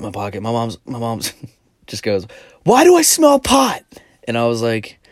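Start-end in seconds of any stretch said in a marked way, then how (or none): 2.6-3.8 high, loud and slow mom accusation; suspicious mom. Retold or imitated. Mom sniffing out weed, slow loud high voice. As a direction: drag it out like a suspicious mother.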